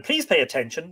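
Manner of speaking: ordinary delivery, nothing marked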